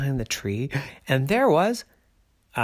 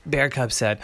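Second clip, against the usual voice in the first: ordinary delivery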